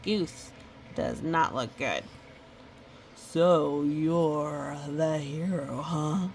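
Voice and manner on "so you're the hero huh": slow, lower sly wolf taunt. Acted. Wolf taunting Goose he has caught, slow and smug. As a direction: purr it like a smug predator.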